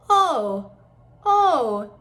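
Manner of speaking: loud, open-mouthed "oh"; full open "oh" demo. Shown as a demonstration. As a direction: call a round "oh", tongue flat. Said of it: "on that open O".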